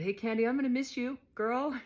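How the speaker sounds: high, loud, cheerful send-off; excited goodbye. Retold or imitated. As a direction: call out to her with affection. Fond goodbye in prison.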